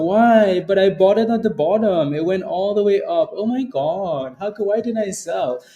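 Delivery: high, anxious whining voice; panicky trader. Retold or imitated. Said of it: "your emotions, like".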